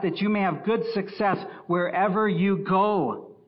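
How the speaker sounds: loud, high, driving pulpit voice; forceful preaching. Acted. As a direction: thunder the verse at the congregation. Preaches scripture at full force.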